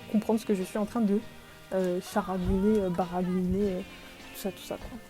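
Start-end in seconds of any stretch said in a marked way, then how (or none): none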